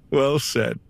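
laughing through the praise. Acted. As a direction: chuckle as you say it. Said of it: "Well said repeated".

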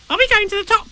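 tiny, very high squeaky youngster; high-pitched eager little voice; Roo's voice. Acted. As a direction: squeak the question like a curious little one. Roo eagerly questions Tigger.

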